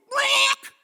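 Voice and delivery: loud imitated chicken squawk; one harsh, loud "brawk". Shown as a demonstration. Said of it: "we have to go".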